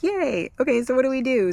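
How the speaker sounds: high, bright yay then eager question; mock cheer. Patient relieved by the diagnosis, high mock cheer.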